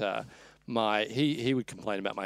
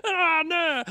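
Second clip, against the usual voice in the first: mouth full; 5 dB louder than usual. Retold or imitated. Patient answering with mouth full.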